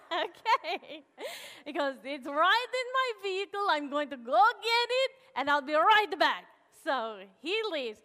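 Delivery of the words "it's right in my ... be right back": put-on a light Russian accent, louder and higher; imitating Vladimir's Russian accent; a touch of a Russian accent for the repairman. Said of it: "this Russian man, Vladimir".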